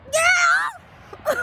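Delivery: a sudden high yelled yeah; shocked yell. Real, not acted. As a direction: blurt it out in disbelief. Shocked outburst at the scene.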